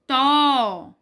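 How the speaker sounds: tó alone, long and emphatic; tó drawn out with weight; stressed TÓ. Shown as a demonstration. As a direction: hold tó as the strong syllable. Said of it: "a sílaba mais forte é o".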